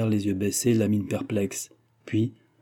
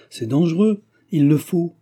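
raised, emphatic pronouncement; firm insistence; 6 semitones higher, 6 dB louder than usual. A narrator's reading. Brother insists one of them must marry, firm and emphatic. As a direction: state it louder and higher, decisively. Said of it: "répéta nettement Germain".

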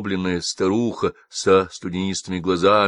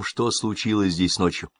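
ordinary delivery

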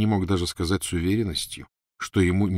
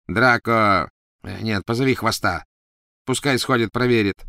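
calling out orders; 3 semitones higher, 4 dB louder than usual. A narrator's reading.

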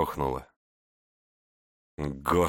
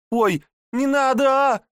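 high, loud cry of pain; 8 dB louder than usual. Acted. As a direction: plead as arms are twisted. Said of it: "несчастная закричала от боли".

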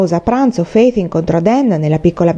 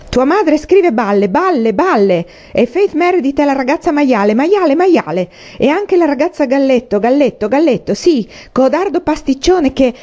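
high, singsong string of insults; jeering taunts; 7 semitones higher than usual. A narrator's reading. Bully mocks boy's mother and friend, jeering. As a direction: hammer the repeated insult in a high jeer.

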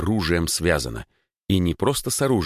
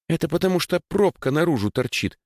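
put-on much higher girlish voice; girl pointing out; 11 semitones higher than usual. A narrator's reading. Даша explains to Егор why patching fails, high-voiced.

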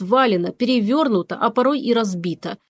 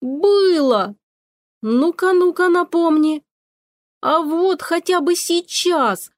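heated quarrel; 7 semitones higher, slower than usual. A narrator's reading. Cat quarrels with the witch.

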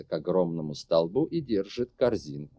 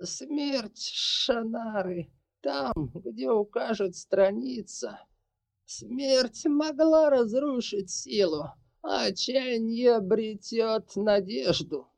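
slow, changed prophetic rasp; shifting shapeshifter voice; 13 semitones higher, slower than usual. A narrator's reading. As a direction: intone the prophecy in an otherworldly voice. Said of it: "её голос поменялся, и она прохрипела".

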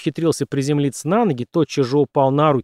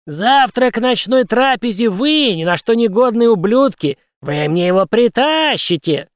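shrill, slow, loud screech; shrill screeching bird woman; 8 semitones higher, 4 dB louder than usual. Acted. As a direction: shriek the order in rage. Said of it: "пронзительно завизжал Харибин". (пронзительный визг)

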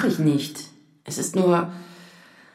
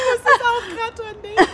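laughing through tears; 10 semitones higher than usual. Acted. Laughing and crying over memory.

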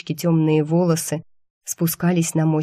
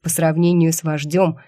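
usual speaking voice

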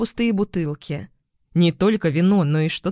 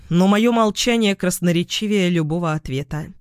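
usual speaking voice

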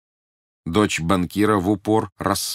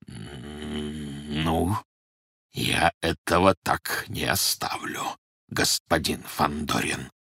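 slow, low-voiced menace; sinister; 4 semitones lower, 4 dB quieter, much slower than usual. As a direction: promise revenge, slowly and darkly. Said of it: "зловеще произнёс Бурляев".

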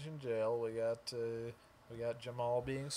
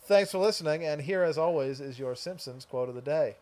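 louder host sign-off voice; podcast outro; 7 dB louder than usual. Acted. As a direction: wrap up the show for listeners. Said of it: "now I'm giving the actual thing".

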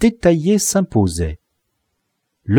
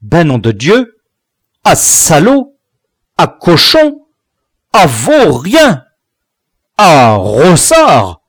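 loud exclamations, pitch leaping up; drawn-out, swooping angry insults; growled outrage; 6 semitones higher, 8 dB louder, slower than usual. A narrator's reading. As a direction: react with mounting shock at each discovery. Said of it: "grognait le père après chaque découverte".

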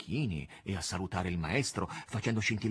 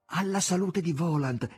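high and loud raised toast; toast exclamation; 8 semitones higher, 6 dB louder than usual. A narrator's reading. Margherita toasts Voland with raised glass, exclaiming. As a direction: lift the glass and cry out the toast. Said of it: "esclamò Margherita".

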